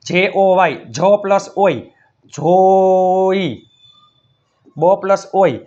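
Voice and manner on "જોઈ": જોઈ with the O held very long, gliding into I; drawn-out blend. Shown as a demonstration. Teacher joining sounds for pupils, જોઈ stretched long. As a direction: stretch જોઈ slowly through the vowel. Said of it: "કરો ભેગું".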